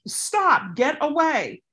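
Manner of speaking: high sharp shout; yelling. Shown as a demonstration. Showing viewers what to yell, high shout. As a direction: yell it to draw attention. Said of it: "So, like".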